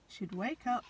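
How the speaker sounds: ordinary delivery, nothing marked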